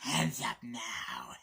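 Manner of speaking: slow menacing whisper; slow creepy whispered order. Retold or imitated.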